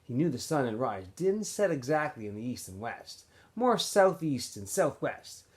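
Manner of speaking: usual speaking voice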